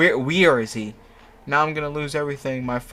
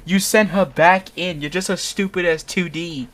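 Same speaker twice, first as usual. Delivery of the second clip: loud, higher angry outburst; mock furious scolding; angry scream; 5 semitones higher, 4 dB louder than usual. Narrator screaming angrily at Murdoch, loud and high. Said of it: "I scream angrily".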